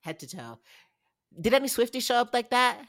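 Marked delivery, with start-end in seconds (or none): 1.4-2.8 louder, higher, fast-fired rant; indignant challenge. Real, not acted. Podcast host calls out Swifties' outfits, indignant. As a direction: throw it down as a fast, loud challenge.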